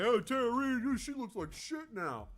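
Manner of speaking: high, loud sneering voice with a drawn-out "she looks like shit now"; mock snide judgment. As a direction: sneer it out like a catty gossip, slowly. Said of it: "I've been that person who've been like".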